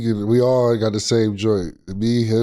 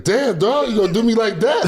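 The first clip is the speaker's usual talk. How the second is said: much higher mock voice, playful betrayal; mock hurt friend; 11 semitones higher than usual. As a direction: pitch it way up like a teasing buddy. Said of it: "He's like".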